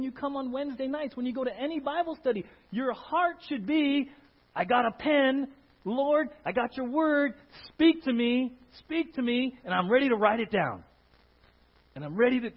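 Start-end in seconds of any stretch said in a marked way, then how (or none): 4.5-10.8 high, loud, eager plea; eager prayer. Retold or imitated. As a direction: beg God to speak, full of zeal. Voicing worshipper's eager prayer.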